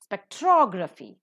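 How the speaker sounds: Spectrography with TROG accented; stress on second syllable. Shown as a demonstration. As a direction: hit spec-TROG-raphy on the second syllable. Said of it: "the second syllable is accented".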